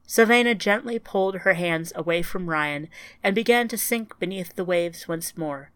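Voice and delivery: usual speaking voice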